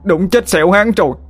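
very high, hammed-up lament to the heavens; theatrical keening cry; mock wailing scream. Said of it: "hai tay giơ lên trời mà gào thét".

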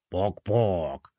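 low clucking pok-pok; chicken clucks. A narrator's reading. Koko's chicken voice. (одобрительное куриное квохтанье)

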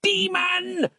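put-on old monk crying out in alarm, very high; cried out. A narrator's reading. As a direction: recoil and cry out at the evil. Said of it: "cried Harland".

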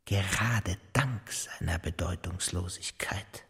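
usual speaking voice

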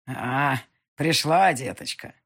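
put-on creaky old witch voice; grating voice. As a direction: rasp it like a sly old witch. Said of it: "услышала я скрипучий голос".

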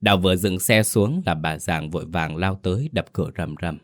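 plain reading voice

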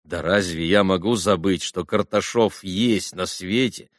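ordinary delivery, nothing marked

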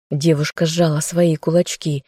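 usual speaking voice

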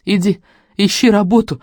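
angry. A narrator's reading. Mother orders daughter to find work, angry. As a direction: order her off with rising anger. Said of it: "маму затрясло от гнева".

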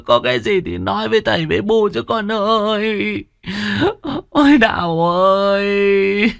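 put-on high, keening wail calling out to the dead child; wailing in grief. Parents wail over their dead daughter's body, high sobbing lament. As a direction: howl the lament as if your heart is torn out. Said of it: "càng rú lên khóc to hơn".

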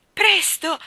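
high, loud cry of urgency; urgent exclamation. A narrator's reading. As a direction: call out to hurry. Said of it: "esclamò Jane".

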